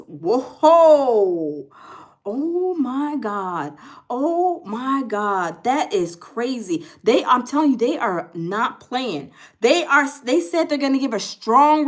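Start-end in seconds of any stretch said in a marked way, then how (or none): 0.1-5.6 slow, drawn-out stunned gasp of disbelief. Real, not acted. Reacting live to the news of the strikes, slow stunned disbelief. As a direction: let each word hang, floored.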